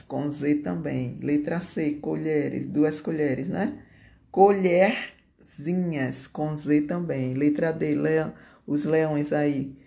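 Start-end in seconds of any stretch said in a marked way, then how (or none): 4.3-6.3 "Colherzinhas" split before the ending; stretched "Colherzinhas" with a pause inside; slow dictation. Shown as a demonstration. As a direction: dictate "Colherzinhas" in two drawn-out parts. Teacher dictates the diminutive in parts.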